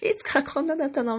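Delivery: mock-astonished questioning; retold astonishment.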